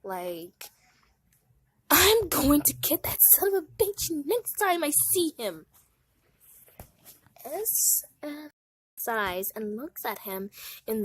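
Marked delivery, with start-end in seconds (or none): 1.9-5.7 angry vow. Acted. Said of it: "very mad".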